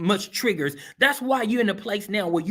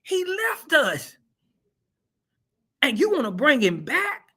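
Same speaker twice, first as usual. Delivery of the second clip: high, indignant mock outrage; Paul's outrage; 7 semitones higher than usual. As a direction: play Paul, fed up and betrayed. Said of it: "he was like".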